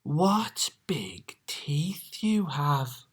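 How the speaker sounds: slow breathy whisper; frightened hush. Acted. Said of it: "Little Red whispered".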